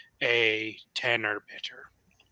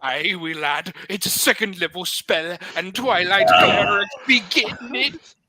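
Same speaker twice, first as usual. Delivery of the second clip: lightly Irish, rising higher and louder; Irish accent; 6 semitones higher, 6 dB louder than usual. Acted. Cleric answering a doubting player about his spell in a light Irish accent. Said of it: "stay Irish and not Scottish".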